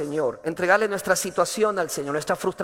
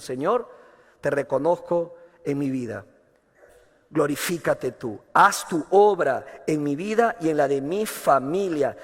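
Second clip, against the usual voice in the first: humble prayer; 5 semitones lower than usual. Retold or imitated. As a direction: pray it gently, bowed before the Lord. Humble surrendered prayer voiced.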